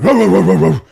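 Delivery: loud mock barks; dog barking. German Shepherd barks at the intruder, loud mock barking. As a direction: bark like a guard dog. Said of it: "the German Shepherd".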